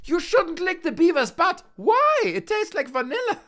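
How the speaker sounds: a shrill little cartoon critter scolding; high cartoon voice, squeaking Why; squeaky cartoon voice. Playful bit about beaver toy.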